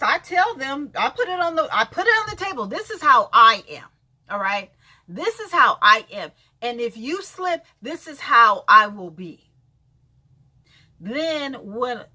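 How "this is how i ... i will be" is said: firm warning. Real, not acted. Laying down terms.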